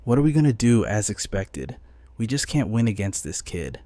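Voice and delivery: ordinary delivery, nothing marked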